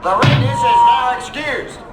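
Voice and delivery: high, loud, slow dismissal; forceful announcement. Acted. Senator dismissing the witness, loud and slow. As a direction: end the session with authority.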